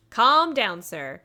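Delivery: ordinary delivery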